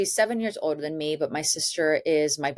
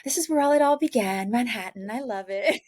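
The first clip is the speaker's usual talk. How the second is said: excited gush; 7 semitones higher than usual. Retold or imitated. Retelling her arrival in Manhattan, high excited gush. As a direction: gush like a kid arriving in the city. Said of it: "I get all excited and a little jittery".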